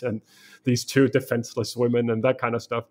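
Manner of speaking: ordinary delivery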